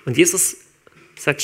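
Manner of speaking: normal talking voice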